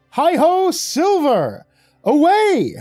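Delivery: very high, loud holler; hammed-up, whooping cry; triumphant cowboy call. A narrator's reading. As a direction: call out like a cowboy hero riding off. Devil mimics cowboy call.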